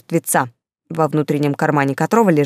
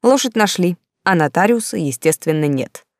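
ordinary delivery, nothing marked

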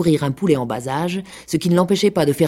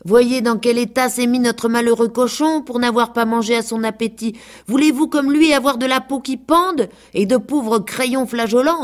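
higher preachy hen voice; lecturing hen; 6 semitones higher than usual. A narrator's reading. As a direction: scold the girls like a fussy old hen. The hen Loi lectures girls.

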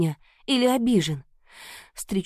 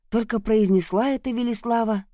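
ordinary delivery

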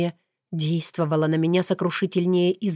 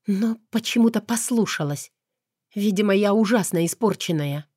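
ordinary delivery, nothing marked

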